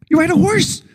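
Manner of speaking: high sing-song you ride a horse; mock obvious answer. Comic answering his own question, high mock-obvious tone.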